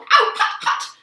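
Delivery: loud pained yelps; yelling in pain. A narrator's reading. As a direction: cry out at the burning whip. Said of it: "he yelled".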